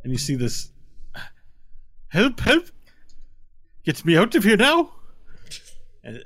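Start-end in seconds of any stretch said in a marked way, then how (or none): 2.1-4.9 high frantic cries for help; calling for help. Acted. Buried little man yelling to be pulled out. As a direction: shout up out of the sand for rescue.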